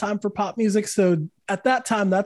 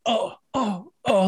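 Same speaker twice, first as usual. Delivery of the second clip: pained groans; 3 semitones higher, slower than usual. Retold or imitated. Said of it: "like another knife in the heart".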